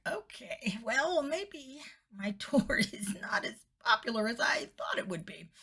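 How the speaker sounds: drawn-out, breathy backpedalling with a laugh; hesitant letdown. Retold or imitated. Realizing the tour got two likes, softly sheepish. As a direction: start high, then slowly sink into soft doubt.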